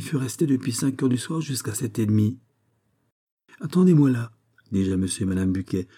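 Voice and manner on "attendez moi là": calling instruction. A narrator's reading. Narrator calls to couple to wait.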